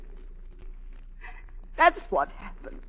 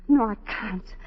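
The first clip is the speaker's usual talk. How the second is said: rising, louder desperate "I can't"; anguished refusal; 3 semitones lower, 3 dB louder than usual. Acted. Wife refusing her husband's kiss, anguished and louder. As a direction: break down as you refuse him.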